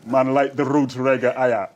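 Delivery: loud, hammed-up Scot putting on Jamaican patois; Jamaican Scottish accent. Scottish fan raves about roots reggae over a drink, mock Jamaican-Scottish accent. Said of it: "this Jamaican Scottish thing".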